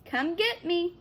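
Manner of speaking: high, slow sing-song taunt; boy mocking the danger; teasing call. A narrator's reading. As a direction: lure the shark like a pet. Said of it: "Monty called through the cupped hands".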